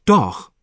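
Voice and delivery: doch said alone, clear and set apart with a falling close; teaching a word. Shown as a demonstration. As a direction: hold up doch as a single word for learners. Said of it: "我还想再讲一讲小品词doch".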